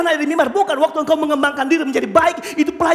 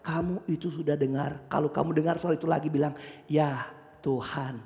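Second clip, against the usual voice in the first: very low, soft and slow; slow right down to a grave near-murmur; hushed instruction; 13 dB quieter than usual. Acted. Priest instructing young Samuel, hushed and very slow.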